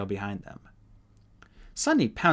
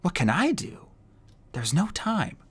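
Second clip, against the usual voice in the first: frantic; 9 semitones higher than usual. A narrator's reading. Clay frantic.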